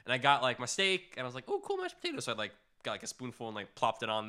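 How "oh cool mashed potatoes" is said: high, lilting mock-delighted "oh"; pleased exclamation. Narrator quoting their younger self at the buffet, pleased exclamation. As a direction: play the naive kid finding a treat.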